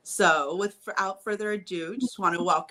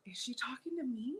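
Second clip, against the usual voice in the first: higher, softer question to oneself; retold puzzled thought; 5 semitones higher, 10 dB quieter than usual. Retelling her reaction to the greeting, puzzled aside. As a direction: wonder privately, voice rising at the end. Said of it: "I remember thinking".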